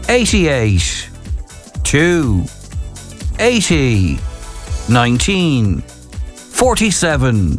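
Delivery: slow, measured number read-back; bingo caller. Acted. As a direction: spell out each number slowly. Reads the numbers back slowly.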